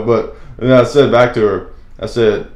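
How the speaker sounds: normal talking voice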